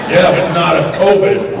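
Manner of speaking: loud stubborn comeback; defiant shout. Retold or imitated. Defiant retort to medics.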